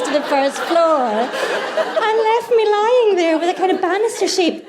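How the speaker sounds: high-pitched giggling through the words, slowed down; laughing while telling. Real, not acted. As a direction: laugh your way through the disaster story. Laughing through the mattress disaster.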